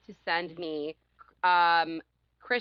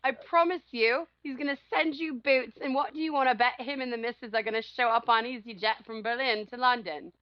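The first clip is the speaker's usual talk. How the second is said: mock outraged boyfriend; 4 semitones higher than usual. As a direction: play the jealous boyfriend ranting, pitched up. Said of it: "He was like".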